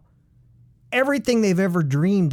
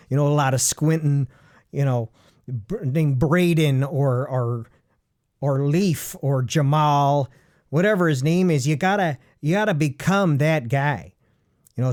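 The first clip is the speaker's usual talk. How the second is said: normal talking voice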